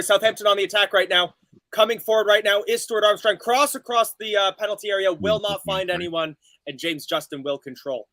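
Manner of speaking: rising live match call; play-by-play commentary. Acted. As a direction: call the attack as it builds.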